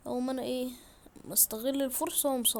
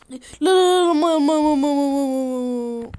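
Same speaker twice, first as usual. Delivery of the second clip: much louder frantic cries; panicked yelling; 12 dB louder than usual. Real, not acted. Gamer sliding into the water, yelling in panic. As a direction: shout in alarm.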